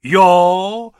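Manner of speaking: loud, slow, emphatic threat; drawn-out forceful shout; shouted warning. A narrator's reading. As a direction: bellow back with grim relish. Pilot shouts a threat back.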